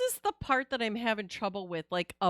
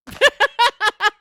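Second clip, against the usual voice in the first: loud high cackle of laughter; much higher, louder real laugh; 12 semitones higher, 14 dB louder than usual. Real, not acted. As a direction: cackle loudly at the joke. Laughing at bot name.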